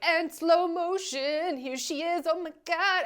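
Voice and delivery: high, stretched-out hype voice; hammed-up dramatic reveal; mock slow-motion reveal. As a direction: play the overdone editing, drawing it out. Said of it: "the editing was like".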